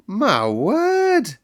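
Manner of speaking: admiring cry. Acted. Mary praises Colin's Yorkshire, crying out. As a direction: exclaim in admiring delight. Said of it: "cried Mary admiringly".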